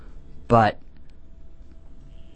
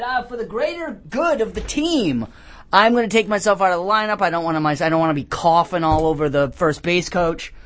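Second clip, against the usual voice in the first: lightly acted, higher-pitched self-sacrificing player; mock-noble ballplayer; 7 semitones higher than usual. Host quotes the player bowing out, mock-noble. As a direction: start high, then rush through the excuse.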